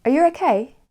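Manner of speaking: "okay" swept up high, loud; rising question tune. Shown as a demonstration. Teacher gives learners an example question, rising on "okay". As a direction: ask it loudly, climbing through "okay". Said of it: "our voice goes up at the end".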